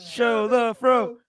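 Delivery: high, slow, loud calling out; drawn-out loud call. Real, not acted. Repeats call to show hair.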